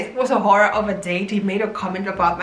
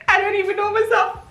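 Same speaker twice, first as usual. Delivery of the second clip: laughing; 10 semitones higher, faster than usual. Real, not acted. Laughing at herself.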